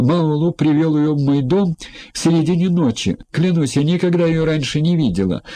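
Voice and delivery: high-pitched, protesting woman's voice; whiny madam. A narrator's reading. Brothel madam justifying herself to the judge, whiny and protesting. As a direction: keep the pitch up, plead innocence.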